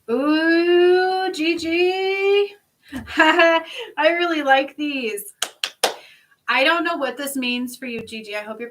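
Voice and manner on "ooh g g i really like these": higher, louder, slow and laughing; delighted. Real, not acted. Reader to viewer, delighted by the cards drawn. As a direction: coo over the cards with glee.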